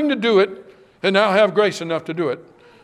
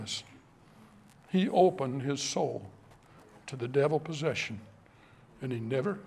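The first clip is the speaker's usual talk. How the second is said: hushed earnest appeal; 4 semitones lower, 9 dB quieter than usual. Acted. Preacher drops to hushed appeal.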